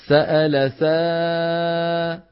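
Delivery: thin alif in سأل سا; slow demo. Shown as a demonstration. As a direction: stretch سا slowly and keep it light, never heavy like صا. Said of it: "ما منقول صا".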